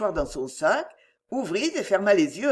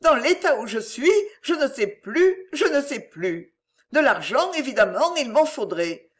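higher-pitched, distraught woman putting on helplessness; self-pitying mother, flustered; 5 semitones higher, 3 dB louder than usual. Acted. Mother naming her price while acting lost, flustered. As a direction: play bewildered and overwhelmed. Mother acts bewildered victim.

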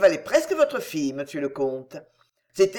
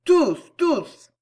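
high, loud cheering shout; 8 semitones higher, 5 dB louder than usual. A narrator's reading. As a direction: cheer out loud while clapping. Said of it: "applaudissait bruyamment et criait".

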